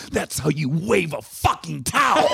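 slow, high, triumphant crow through a laugh; gloating punchline. Acted. Telling a Passover joke, the husband brags to the rabbi, laughing. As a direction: savor it, laughing, like the proudest man alive.

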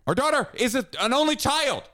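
high-pitched incredulous protest; indignant rant. Real, not acted. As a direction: argue it high and exasperated, like it's absurd. Indignant rant in marital argument.

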